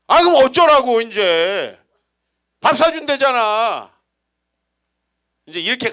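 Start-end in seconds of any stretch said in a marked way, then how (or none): 0.1-4.0 loud, exasperated snap back; irritated retort. Retold or imitated. Husband snapping loudly at his wife, exasperated. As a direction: throw it back at her, fed up.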